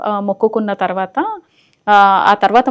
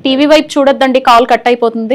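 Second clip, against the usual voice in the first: loud, higher-pitched warning down the line; raised-voice warning; 4 semitones higher, 7 dB louder than usual. Real, not acted. Host warns caller the call will drop, raised voice. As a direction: project it to a distracted caller.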